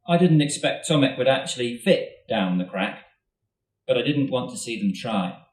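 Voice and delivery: ordinary delivery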